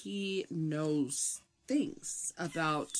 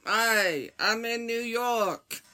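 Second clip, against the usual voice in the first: badly faked New York accent, higher and drawn out; mock New York accent; 4 semitones higher, 5 dB louder, slower than usual. Midwestern co-host pretending to be a New Yorker, mock New York accent. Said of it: "pretending to be from New York".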